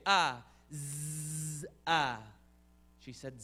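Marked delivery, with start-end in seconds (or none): 0.1-2.2 short "ah" yelps round a long buzz; bee sting cry and buzz. Shown as a demonstration. Teacher asking what you say when bitten, buzz and "ah". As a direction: buzz like a bee, then a punched "ah". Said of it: "when it bites you, what do you say?".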